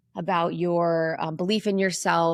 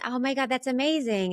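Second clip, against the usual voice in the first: put-on much higher, bright girly gush in the head; mock high head voice; 7 semitones higher than usual. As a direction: parody the way women are taught to sound up in the head. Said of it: "we're taught to be in our head".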